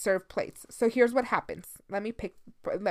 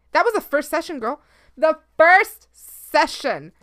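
emphatic; 6 semitones higher, 9 dB louder than usual. Real, not acted. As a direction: stretch the first session out loud. Emphatic revelation.

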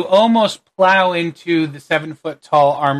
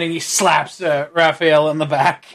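normal talking voice

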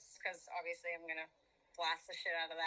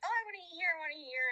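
mock whiny friends; 8 semitones higher, 6 dB louder, much faster than usual. Mocking hungover friends' whining.

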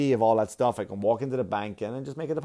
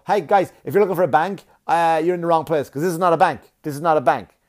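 loud, raised-pitch public warning; obnoxious heckling; 5 semitones higher, 8 dB louder than usual. Retold or imitated. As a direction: call out obnoxiously to passing customers. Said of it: "That was the asshole in me".

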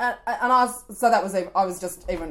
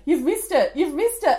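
higher, louder teasing call; teasing parents; 8 semitones higher, 4 dB louder than usual. Retold or imitated. Parents at school teasing the late mum, louder and mocking. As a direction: rib her like a cheeky parent. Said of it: "see me and go".

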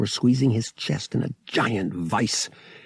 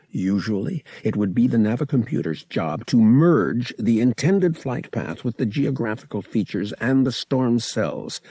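ordinary delivery, nothing marked

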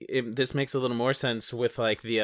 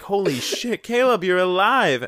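mock surprised cry; 6 semitones higher, 4 dB louder than usual. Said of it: "we're just like".

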